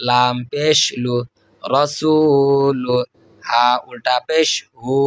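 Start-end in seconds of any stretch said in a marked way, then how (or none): 1.6-3.0 stretched reading of রসুলু; slow recitation. Shown as a demonstration. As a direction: draw out রসুলু with long vowels. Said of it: "demo of joined reading".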